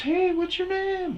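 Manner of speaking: put-on very high, loud falsetto girl; high girly voice. Comic voicing the concert girl, very high falsetto. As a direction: shriek it like a bubbly girl.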